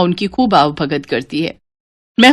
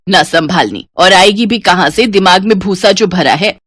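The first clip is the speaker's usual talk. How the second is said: ordinary delivery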